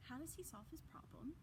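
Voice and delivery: ordinary delivery, nothing marked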